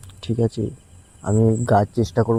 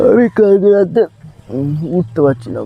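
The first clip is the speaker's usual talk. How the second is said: much higher and louder, words forced out with back pain; straining in pain; 7 semitones higher, 5 dB louder than usual. Real, not acted. Getting up in the car with a sore back, strained cry. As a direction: push the words out while trying to sit up. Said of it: "কোমরটা এত লাগছে বাবারে".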